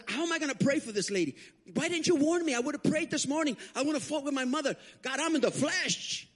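high-pitched, exasperated pleading; mad at God. Retold or imitated. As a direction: wail the complaint, stretching the last line. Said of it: "I got mad at God".

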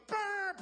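loud revving brr, pitched high; engine brr. Retold or imitated. Preacher imitating his car swerving into the donut shop, loud revving brr. Pulling into Krispy Kreme lot.

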